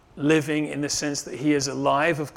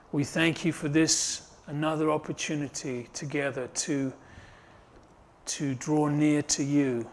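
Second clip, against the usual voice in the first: closing prayer; 4 dB quieter than usual. Acted. Pastor leading the congregation in closing prayer, hushed.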